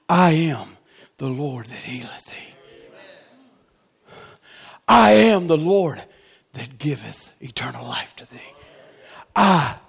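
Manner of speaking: hushed, tender whisper; soft whispered divine words; whispered voice of God. Acted. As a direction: whisper it like God speaking close. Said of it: "What's he saying".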